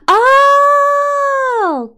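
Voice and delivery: long, high cry of dismay. Real, not acted. Player crying out at a game mishap, long dismayed wail.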